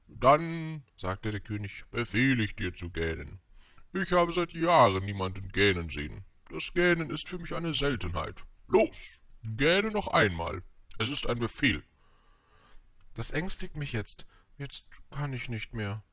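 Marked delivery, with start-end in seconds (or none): 0.1-0.8 high, loud drawn-out royal word; pompous storybook king; commanding king. A narrator's reading. 1.9-11.8 grand royal voice with loud stresses; imperious storybook monarch; pompous king, commanding. A narrator's reading. 13.1-16.0 mildly anxious, pitched up; understated timid prince; stammering little prince. A narrator's reading. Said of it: "stammelte der kleine Prinz".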